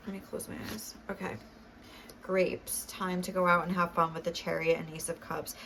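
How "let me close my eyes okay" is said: whispered aside. Real, not acted. Focusing before card pull.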